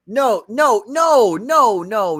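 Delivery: loud, high, drawn-out no's; emphatic objection. Real, not acted. Cutting in to object loudly.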